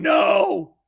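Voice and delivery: loud, drawn-out mock "no" shout; imagined aunt's protest. Aunt probably reacting to the story, mock protest shout.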